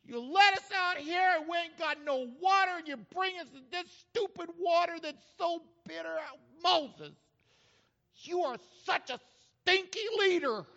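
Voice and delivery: high, hammed-up whiny outrage; upset grumbling. Israelites grumbling at Moses for water, exaggerated upset. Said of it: "They were so upset at Moses".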